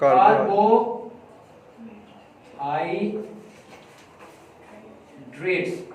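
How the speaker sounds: Carbohydrates stretched very slowly, syllable by syllable; very slow syllable-by-syllable reading; drawn out by syllable. Shown as a demonstration. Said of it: "एक word में लेता हूं".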